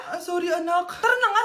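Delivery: ordinary talk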